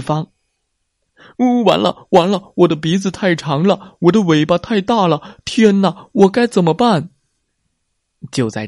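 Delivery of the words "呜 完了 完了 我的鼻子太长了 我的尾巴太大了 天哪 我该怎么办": high, loud panicked whimper; panicked wail. A narrator's reading. Big brother trapped by the leopard, wailing in panic. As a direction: cry out in helpless fear.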